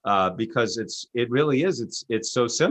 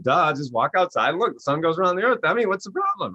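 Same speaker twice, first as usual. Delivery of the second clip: mocking know-it-all; 6 semitones higher than usual. Mocking geocentric believers.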